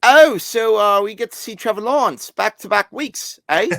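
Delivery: cheeky British exclamation; a British fan teasing the co-host; British accent. Pretend. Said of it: "doing my British accent".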